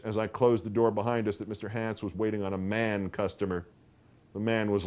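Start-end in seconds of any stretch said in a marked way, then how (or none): none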